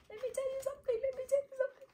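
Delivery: high squealed teasing voice, repeated; mock diva voice. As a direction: tease her in a high put-on voice. Playful high put-on voice. (voix aiguë de diva, pour rire)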